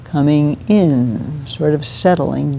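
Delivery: soft, slow and drawn out; gentle grounding. Shown as a demonstration. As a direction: let each key word settle gently, like coming home. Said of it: "a very gently grounding sound".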